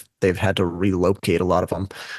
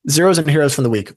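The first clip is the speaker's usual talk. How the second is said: higher, louder, quick title read; upbeat announcement; 6 semitones higher, 5 dB louder, faster than usual. Shown as a demonstration. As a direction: toss it off with energy. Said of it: "repeating the segment title brightly".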